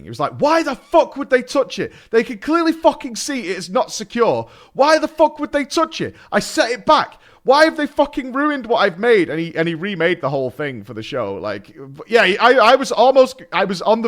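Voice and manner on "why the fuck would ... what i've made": high, loud, furious rant; imitating a raging set designer. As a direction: mimic an outraged set designer demanding why anyone touched his set. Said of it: "he was raging".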